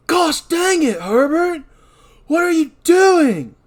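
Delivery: high, loud and slow; shouting. Acted. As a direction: yell it at him in disbelief. Dan voiced, shouting at Herbert.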